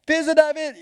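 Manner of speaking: high, loud call of fils de David; blind man's cry. Retold or imitated. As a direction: shout to be heard over the crowd. Bartimée crie encore.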